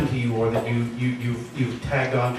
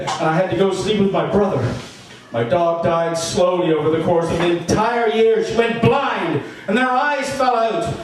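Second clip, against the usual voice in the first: hysterical edge; 8 semitones higher than usual. Acted. Narrator confessing losses, cracking into high laughing tears. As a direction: let the grief tip into unhinged laughter. Pitch soaring, laughing through it.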